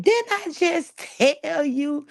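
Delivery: high, slow, sassy put-down with a drawn-out pace; mock the witness's sharp retort; sassy comeback. Witness snapping back at the attorney, sassy and slow.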